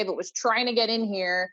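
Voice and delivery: ordinary talk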